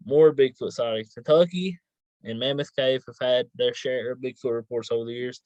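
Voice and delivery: ordinary delivery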